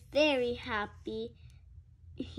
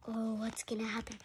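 fast, breathless whisper; excited whispered worry; suspense whisper; 8 dB quieter, much faster than usual. Real, not acted. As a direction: hush it like a secret.

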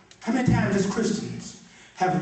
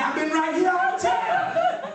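high, laughing shout of a line; laughing preacher shout; excited shout; 14 semitones higher than usual. Retold or imitated.

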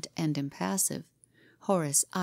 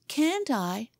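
put-on high, defiant childish retort; mock him with the question; 7 semitones higher than usual. A narrator's reading. Boy throws back his rival's dare, mocking. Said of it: "he mocked".

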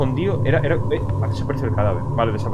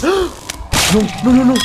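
high, loud panicked "no no no"; panicked shout; 13 semitones higher, 8 dB louder than usual. Real, not acted. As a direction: yell it out in real fright. Scared by monsters in game.

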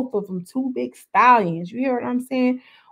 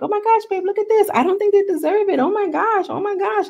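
much higher, excited mock voice of the boyfriend; mocking Ballistic; 11 semitones higher than usual. Mimicking the boyfriend gushing to his babe, high and giddy. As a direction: squeal it like a giddy boyfriend teasing his girl. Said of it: "He's like".

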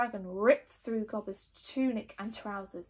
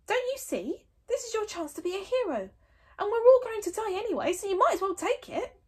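put-on higher eager boy voice; boyish Fishlegs; 11 semitones higher, 4 dB louder than usual. Fishlegs urging his friend Hiccup, higher eager boy. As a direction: urge your friend on brightly.

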